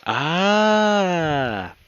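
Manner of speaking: long, loud rising-falling "ah"; drawn-out high cry of realization. Real, not acted. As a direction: let a big "aah" swell and fall. Reader reacts to the story's reveal.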